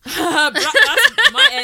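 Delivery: loud, high protest through laughter; laughing protest. Real, not acted. Defending her own end to teasing friends, laughing protest. Teased back about her home area.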